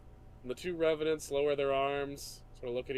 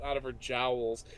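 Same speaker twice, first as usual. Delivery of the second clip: usual speaking voice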